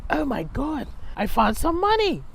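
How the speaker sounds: high put-on gasp of discovery; mock surprise. To her cousin, a high mock-surprised gasp. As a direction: fake amazement at found money.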